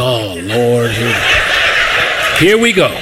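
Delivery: low, resigned "oh Lord"; weary inner groan. Retold or imitated. Pastor hearing a sales pitch, resigned. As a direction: sigh it to yourself. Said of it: "I'm behind the thing like".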